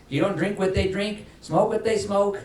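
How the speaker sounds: high, loud, rising preacher's build; building sermon list. Acted. Pastor warning the congregation, in a rising preacher's build. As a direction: drive the list upward with fervour.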